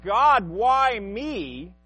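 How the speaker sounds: loud, drawn-out cry to God; a slow, hammed-up plea at full voice. Preacher mimicking believers under spiritual attack, crying out loudly. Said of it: "you cry out".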